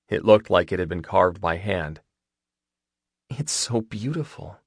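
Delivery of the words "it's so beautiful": soft, slow, higher voice; tender and hushed; hushed awe. A narrator's reading. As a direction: say it slowly in quiet wonder. Moved by the bracelet gift.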